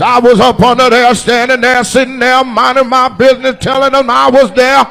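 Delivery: put-on high, loud old-man rambling. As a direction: ramble like you're climbing stairs.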